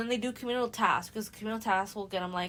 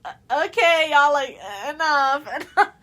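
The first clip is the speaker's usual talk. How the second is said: higher, loud laughing enough-already; laughing protest; 6 semitones higher, 12 dB louder than usual. Retold or imitated. Housemates telling the couple to stop, loud laughing protest. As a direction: break up the kiss while laughing.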